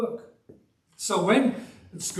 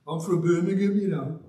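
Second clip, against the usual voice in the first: broad Brummie "I'm from Birmingham, you know"; Birmingham accent. Retold or imitated. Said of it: "I really did speak like that once".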